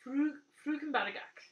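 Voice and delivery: the knitter tries the Norwegian name carefully, pronouncing Frukenbadagak; careful Norwegian try. Shown as a demonstration. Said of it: "I've been practicing this".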